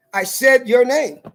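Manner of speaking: every word slow and weighty, I said your name; slow and emphatic. Real, not acted. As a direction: spell it out slowly to the judge.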